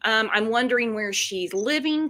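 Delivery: ordinary talk, nothing marked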